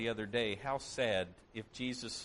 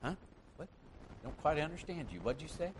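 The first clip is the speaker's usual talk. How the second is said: feigned confusion; 3 semitones higher, 5 dB quieter, faster than usual. Pretend. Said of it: "we pretend".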